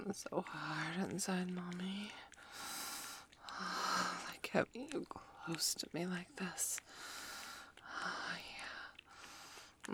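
soft, slow, breathy whispering to a lover; intimate whisper. Acted. Whispering close to her partner in intimate roleplay. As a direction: keep it hushed and close to the ear.

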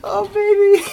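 high, loud laughing cry; laughing shriek. Real, not acted. Hit by her flurry of pillows, a high laughing shriek. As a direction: shriek with laughter under the blows.

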